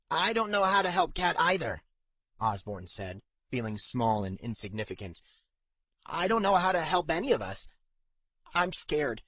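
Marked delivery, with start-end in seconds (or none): 0.1-1.8 higher, louder voice of an overwhelmed friend; exasperated character voice, raised and pushed; helpless protest. Acted. Osborn protests, feeling helpless. 6.1-9.2 higher-pitched, lightly acted distress; mildly overwrought character voice, pitch lifted; scared admission. Osborn admits he is scared.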